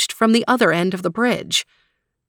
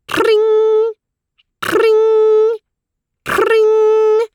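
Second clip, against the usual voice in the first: high bell ring; 6 dB louder, much slower than usual. Acted. Bell rings three times.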